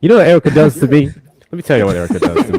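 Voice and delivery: loud, high mock outrage; loud complaint. Real, not acted. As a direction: rant at her, half laughing. Complaining loudly about sister.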